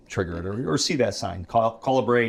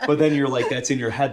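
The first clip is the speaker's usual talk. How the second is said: laughing; 7 semitones higher, 6 dB louder than usual. Real, not acted. Laughing at the Baby Shark bit.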